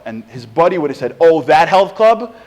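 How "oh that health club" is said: loud, slow, drawn-out realization; knowing recognition. Acted. Buddy hears about the health club, loud dawning recognition. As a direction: stretch it out with rising recognition.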